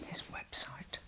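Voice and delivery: "His website" repeated in a whisper. Real, not acted. Urging viewers to look at his website, whispered. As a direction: echo "His website" under your breath.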